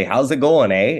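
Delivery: a light Canadian "How's it going, eh?" with the "eh" tacked on; Canadian accent. Shown as a demonstration. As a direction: say "How's it going, eh?" like a true Albertan. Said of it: "I've tried to teach them how to say".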